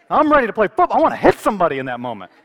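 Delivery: loud, hyped-up outburst; fired up. Acted. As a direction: burst out as if charging onto the field. Fired up by the retold scene.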